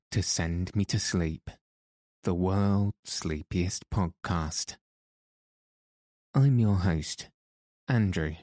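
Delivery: calm them gently toward sleep; sleep story narrator. Acted. Sleep podcast host intro.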